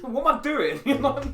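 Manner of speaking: high, loud self-mocking question; laughing exasperation. Gamer who pushed over his Xbox, laughing exasperation. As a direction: laugh in dismay at yourself.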